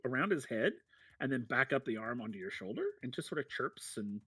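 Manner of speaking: ordinary delivery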